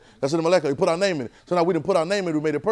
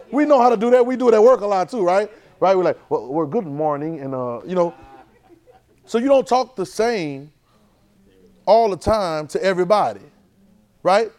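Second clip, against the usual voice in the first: preaching; 6 semitones higher, 8 dB louder than usual. Acted. Preacher builds on code switching.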